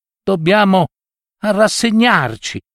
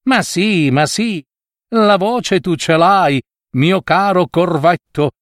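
higher, teasing fox voice, slowed on the jab; mocking fox; 6 semitones higher than usual. Fox trotting off with the meat, mocking the crow. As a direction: gloat and mock the crow as you leave. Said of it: "deridendo il corvo".

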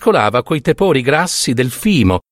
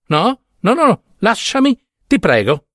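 put-on higher, lively girlish bride talking to her groom; young bride's voice; 8 semitones higher than usual. A narrator's reading. Young bride Ida alarmed at her groom's hot hands. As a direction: play the naive bride, bright and startled. Ida pushes Nino away.

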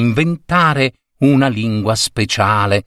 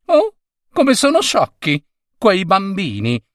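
put-on high, sniffy old lady; old lady's voice; 9 semitones higher than usual. Old woman scorning the children, high and sniffy. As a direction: look down on the silly children. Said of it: "disse la signora".

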